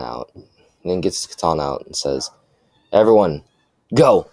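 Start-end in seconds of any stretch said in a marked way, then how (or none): 2.9-4.3 loud, higher rallying call; raised-voice order, each word punched; battle command. A narrator's reading. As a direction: call the charge to the team. Character orders the team to attack.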